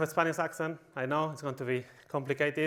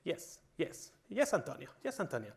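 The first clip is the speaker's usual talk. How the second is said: soft, whispery yes-man murmur; meek mock agreement, voice dropping away; obedient sheep; 7 dB quieter than usual. Sheep-like employees obeying their boss Antonio, meek and soft. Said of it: "of your sheep, that they say".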